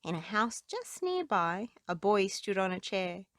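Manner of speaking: ordinary delivery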